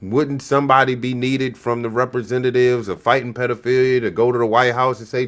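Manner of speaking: ordinary delivery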